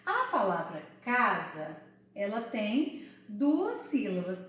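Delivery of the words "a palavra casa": ordinary delivery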